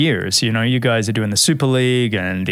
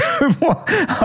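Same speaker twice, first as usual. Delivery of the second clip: high laugh. Real, not acted. Laughs about having covid.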